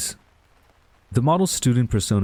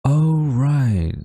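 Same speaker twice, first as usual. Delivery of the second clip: higher, louder oh of remembering; sudden realization; 7 semitones higher, 4 dB louder than usual. Acted. Tutor suddenly remembers the favor she owes, surprised. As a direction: it just clicked.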